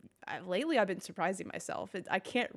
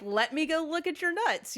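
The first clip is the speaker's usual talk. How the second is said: mock eager; 8 semitones higher than usual. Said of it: "and I'm like".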